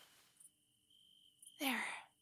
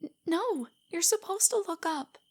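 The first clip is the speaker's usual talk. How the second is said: flustered protest; 6 semitones higher, 5 dB louder than usual. Acted. Flustered when he looks at her.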